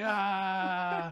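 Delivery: long, high, loud "ah"; imitated long yell. Stallholder joining the shaming, long imitated yell. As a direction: holler like a man ringing a bell. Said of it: "Started ringing a bell".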